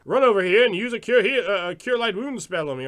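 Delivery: loud, high out-of-character table talk; mock a player calling out game numbers. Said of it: "you're like".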